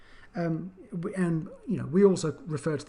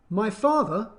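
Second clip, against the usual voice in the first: high, louder on father before the drop; intonation demo; 6 semitones higher, 4 dB louder than usual. Shown as a demonstration. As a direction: set up the clause with a lifted father. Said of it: "we would drop our voice".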